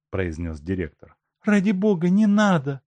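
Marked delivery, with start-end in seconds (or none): 1.4-2.8 high, loud begging wife; pleading. A narrator's reading. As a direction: beg the director to stop. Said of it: "взмолилась Елена Никитишна".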